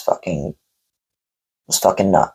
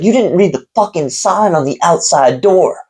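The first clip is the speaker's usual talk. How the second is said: higher, imitated furious yell; retold angry yell; 7 semitones higher than usual. Owner scolding customer about the sign, imitated angry yell. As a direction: bark it at him, pitched up high. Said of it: "he was just going off and off".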